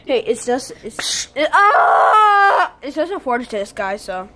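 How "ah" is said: sudden loud frustrated yell. Real, not acted. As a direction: burst out in a yelp of frustration. Stumbling over his words.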